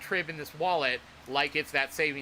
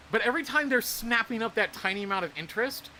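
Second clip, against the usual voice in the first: usual speaking voice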